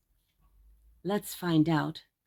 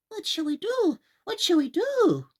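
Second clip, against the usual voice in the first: high fretful mother's voice, a bit louder; worried widow; 11 semitones higher, 5 dB louder than usual. Acted. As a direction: wring your hands as you say it. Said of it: "said the widow, wringing her hands".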